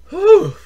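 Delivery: high, loud puff of relief; exerted whew. Acted. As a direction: blow out a big whew. Puffing after mock exercise.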